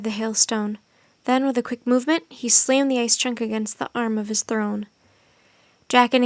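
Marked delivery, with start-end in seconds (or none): none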